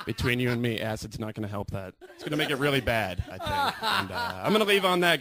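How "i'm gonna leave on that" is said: calling out. Real, not acted. Comic calling out sign-off over applause.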